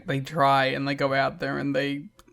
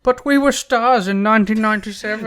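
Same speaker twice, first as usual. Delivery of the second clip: whiny mock complaint; 6 semitones higher, 12 dB louder than usual. Voicing BG James mockingly.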